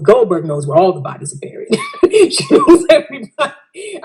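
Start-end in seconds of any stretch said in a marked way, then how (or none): 1.8-2.3 sharp high laugh breaking in; sudden high laugh. Real, not acted. Laughing at her own story. 2.3-4.0 said through laughter, much higher; laughing. Real, not acted. Laughing at her own story.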